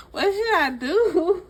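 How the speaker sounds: high, loud, slow laughing question. Real, not acted. Laughing while asking viewers.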